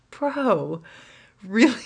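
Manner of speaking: stunned disbelief; incredulous. Real, not acted. Disbelief at his kids story.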